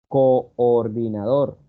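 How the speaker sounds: "coordinador" slowly split, co-or-dinador, both o sounds distinct; two separate vowels. Shown as a demonstration. Teacher showing students a hiatus, slow syllable-split demo. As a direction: stretch "coordinador" so each o gets its own syllable. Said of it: "en dos sonidos diferentes".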